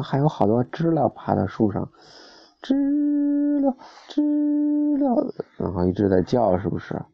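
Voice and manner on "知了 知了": high, far cicada cry; cicada call. Acted. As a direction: sing it like a cicada. Imitating cicadas for child. (学知了叫)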